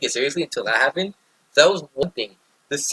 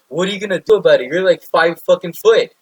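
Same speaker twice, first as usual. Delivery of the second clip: yelling drill instructor; 4 semitones higher, 5 dB louder than usual. Retold or imitated. Drill instructor berating a short recruit, imitated yell. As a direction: get louder and higher, hammering the recruit down. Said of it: "yell, hammers me down".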